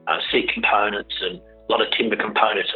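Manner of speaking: usual speaking voice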